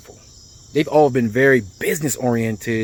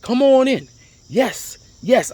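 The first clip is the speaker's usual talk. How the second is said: high-pitched imitation of welcoming staff; retold eager welcome; 10 semitones higher, slower than usual. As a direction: wave them in with a raised, eager voice. Staff eagerly welcome him at airport.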